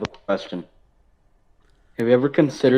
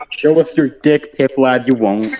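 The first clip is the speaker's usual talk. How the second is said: higher, louder goading jeer; loud taunt; 5 semitones higher, 6 dB louder than usual. Real, not acted. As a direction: dare him loudly. Goading the host in front of others.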